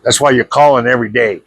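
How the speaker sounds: normal talking voice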